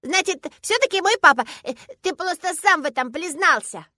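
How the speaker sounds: put-on high, loud childish voice; triumphant little girl. A narrator's reading. Girl seizing on father's admission, triumphant. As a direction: catch him out like a gleeful five-year-old.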